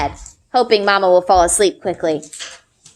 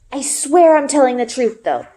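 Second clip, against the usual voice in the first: lightly voiced boy, a bit higher, urging his friend; a hint of an earnest boy pleading to be believed; Ari, eager boy; 5 semitones higher than usual. Ari's voice in read story.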